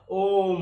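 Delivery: ओम stretched out long; prolated vowel. Shown as a demonstration. Teacher repeating the triple-length example, ओम drawn out. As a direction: hold the ओ of ओम for three beats. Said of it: "तीन गुना समय लगता है".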